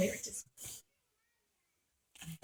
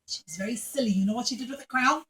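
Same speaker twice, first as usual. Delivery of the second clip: usual speaking voice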